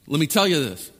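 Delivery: ordinary talk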